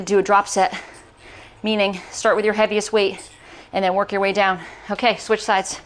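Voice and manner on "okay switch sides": normal talking voice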